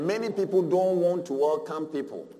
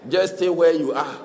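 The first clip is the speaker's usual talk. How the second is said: louder, laughing brush-off; dismissive; 8 dB louder than usual. Retold or imitated. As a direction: wave them away with a laugh. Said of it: "they say".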